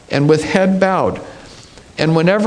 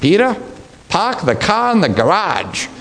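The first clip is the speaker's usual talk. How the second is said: put-on a Boston accent, the r's dropped hard; harsh Boston accent; 3 semitones higher than usual. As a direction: play a blunt Boston local calling out. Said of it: "harsh Boston accent".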